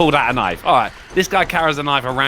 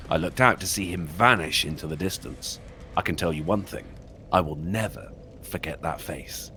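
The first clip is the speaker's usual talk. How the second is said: low, hushed horror narration; creepy story narration; 8 semitones lower, 6 dB quieter than usual. Acted. Streamer reading a creepypasta to chat, hushed and ominous. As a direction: spin a scary tale for the viewers.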